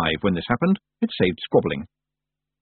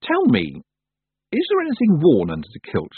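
higher, curious direct question; daring question; 7 semitones higher than usual. A narrator's reading. Tiffany finally dares ask the Feagles, curious and higher-pitched. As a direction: ask carefully, with lifted pitch. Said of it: "she'd never quite dared to ask".

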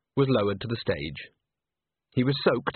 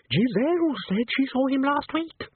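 put-on high ballet girl voice. Babble of voices.

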